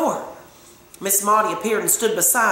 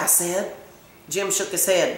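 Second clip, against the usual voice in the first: usual speaking voice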